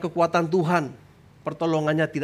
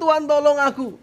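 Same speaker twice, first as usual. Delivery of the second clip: loud, high cry for help; shouted plea; 10 semitones higher, 6 dB louder than usual. Shown as a demonstration. Believer in danger begs God for rescue, loud high cry. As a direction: call urgently up to God. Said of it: "yang perlu Anda lakukan yaitu teriak".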